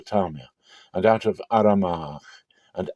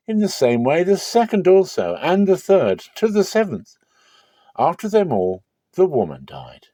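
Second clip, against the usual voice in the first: higher, eager questioner's voice building through the list; Sadducees' riddle; 5 semitones higher than usual. Acted. Sadducees trying to trap Jesus, higher mocking questioner. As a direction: pile up the brothers as a gotcha, pitched higher.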